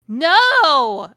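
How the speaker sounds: sudden high-pitched scream of "No!". Acted. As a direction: shriek it in horror. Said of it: "I screamed".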